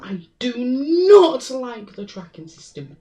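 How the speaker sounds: normal talking voice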